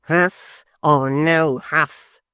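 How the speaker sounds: very slow, lofty declaiming; pompous apprentice. A narrator's reading. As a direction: make every word weighty. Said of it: "said Mister Tappertit".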